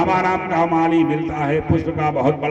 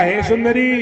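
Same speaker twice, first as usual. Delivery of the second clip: calling out; 7 semitones higher than usual. Acted. Bhagwan hailing Kubja on the road, calling out. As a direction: call to her in a raised voice.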